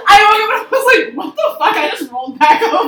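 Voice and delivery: laughing outraged retelling. Retold or imitated. Retelling waking to accusation.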